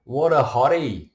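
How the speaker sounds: loud, high Aussie whoop; high, loud Australian yell, hammed up; mock Aussie bloke. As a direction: shout it like a brash Aussie spotting a girl. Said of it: "we're just like".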